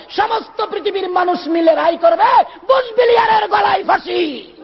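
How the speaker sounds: high, ringing sermon shout; full-force preaching. Real, not acted. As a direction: proclaim judgement in long, impassioned cries. (উচ্চকণ্ঠে জোরালো আবেগী ওয়াজ)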